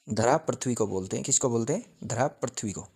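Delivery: ordinary delivery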